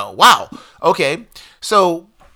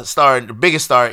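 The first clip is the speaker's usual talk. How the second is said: ordinary talk, nothing marked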